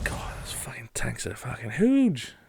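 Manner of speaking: slow awed whisper. Real, not acted. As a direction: whisper it slowly in awe. Whispered awe at tanks.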